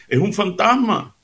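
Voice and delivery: fearful shout of the disciples; frightened cry. Acted. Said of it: "gritaron llenos de miedo".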